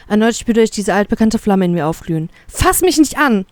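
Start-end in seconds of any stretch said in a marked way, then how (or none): 2.5-3.5 high-pitched, angry rebuff; spat warning. A narrator's reading. Captive to the one holding their arm, spitting. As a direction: lash out at being touched. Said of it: "fauche ich".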